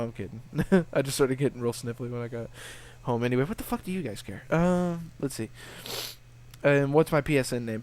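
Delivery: ordinary delivery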